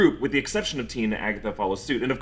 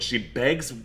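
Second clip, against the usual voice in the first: ordinary delivery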